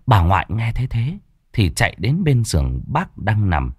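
plain reading voice

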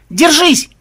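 high, loud urgent call; shouted warning. A narrator's reading. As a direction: shout it to a friend in danger. Shouted warning as crocodile attacks.

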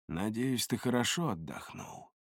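soft, confiding murmur; hushed, private greeting; hushed address. A narrator's reading. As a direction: speak softly to a dangerous companion. Narrator addresses his knife aloud.

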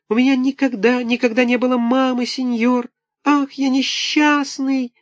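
put-on very high, wailing small-boy voice; pitiful little puppet. A narrator's reading. Buratino pleads with Karabas, high wailing. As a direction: wail like a poor orphan puppet.

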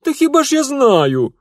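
high, loud defiant snort; snorted defiantly. Acted. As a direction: fire it back defiantly. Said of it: "непримиримо фыркнула".

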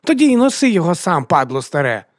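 put-on high, loud child's outburst; high childish scolding, dropping on the insult; angry little girl. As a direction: hurl it like a furious daughter. Daughter angrily insults her father.

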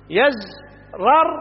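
يزرر said yazrar, slowly; fatha on the ra. Shown as a demonstration. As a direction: drawl يزرر with an open a on the ra: yazrar. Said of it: "بفتح الراء".

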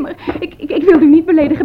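quick, breathless words broken by laughter; laughing protest. Acted. Maid assures the mistress, laughing helplessly. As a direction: giggle through the apology.

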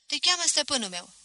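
put-on boy calling in at the café, high and loud; eager message. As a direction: announce it breathlessly from the doorway. Boy delivers message to Voap.

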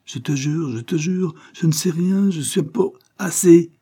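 terrified pleading. A narrator's reading. Tambol swearing to the natives he knows nothing, terrified. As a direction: beg for your life. Boy pleads under threat.

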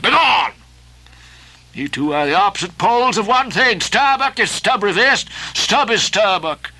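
defiant Ahab. Acted. Ahab's defiant speech.

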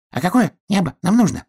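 high-pitched hurried question; calling while running. A narrator's reading. Hedgehog to bear while running with the sky, high squeaky question. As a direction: ask it high, as if on the run. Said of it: "на бегу спросил ежик".

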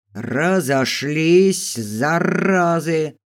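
slow, drawn-out roar of a man breaking up a fight; booming bark. A narrator's reading. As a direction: bellow it with every word stretched. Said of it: "зычно рявкнул мужской голос". (зычный мужской рявк)

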